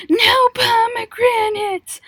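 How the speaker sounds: very high, loud, slow outraged cry; angry teacher meme. Retold or imitated. Siblings quoting the no-pomegranates teacher meme, loud, high and slow. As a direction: shriek it like a furious teacher banning fruit. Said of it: "Like the meme of that teacher".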